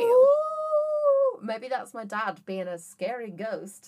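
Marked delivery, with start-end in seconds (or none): 0.0-1.4 high, loud wailing "ooh"; wind howl imitation. Friend mimicking the squealing wind for co-host, high loud howl. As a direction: howl like wind through a gap. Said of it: "It went".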